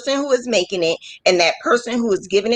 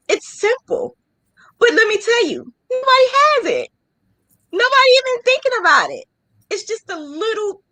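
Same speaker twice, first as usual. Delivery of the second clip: proud boasting; 11 semitones higher than usual. Real, not acted. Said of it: "boasting about unique design".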